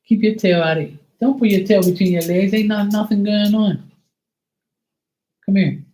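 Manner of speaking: high, loud sing-song voice for the dog; soothing a scared dog. Real, not acted. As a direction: talk up high to calm the pup.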